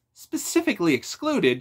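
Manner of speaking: high, loud, indignant disbelief; rising toward an outburst; voice ramping up. A narrator's reading. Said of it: "his voice ramping up".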